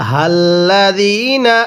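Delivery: হাল্লাযীনা with the long vowel held one alif, slow; drawn-out Quranic recitation. Shown as a demonstration. Teacher demonstrating the long vowel to students, slow recitation. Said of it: "এক আলিফ টেনে পড়তে হবে".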